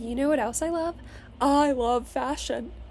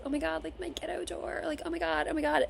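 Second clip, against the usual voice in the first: higher, softer self-mocking fretting; insecure inner voice; 4 semitones higher, 7 dB quieter than usual. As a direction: mimic your own anxious inner voice, up high and hushed. Mocking her own insecure thoughts.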